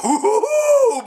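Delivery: high, laughing ooh of delight; excited laughing ooh. Real, not acted. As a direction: squeal a happy ooh. Excited about Thor movie.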